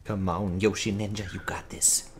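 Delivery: low, very soft urging; root for them under your breath; hushed encouragement. Real, not acted. Gamer rooting for Yoshi Ninja, hushed encouragement.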